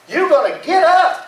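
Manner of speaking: high, insistent command; acted no-nonsense demand; emphatic order. Captain making sleeping Jonah rise, emphatic order. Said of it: "he meant it".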